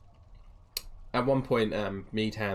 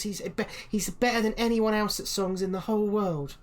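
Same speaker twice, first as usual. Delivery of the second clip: put-on much higher, eager child pleading; children begging the bard to play; excited kid; 10 semitones higher than usual. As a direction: pester him like a giddy little kid. Kids praising bard.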